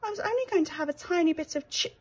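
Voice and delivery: high, anxious little mouse voice; worried he's done wrong, small and unsure; little mouse asking nervously; timid little mouse. Acted.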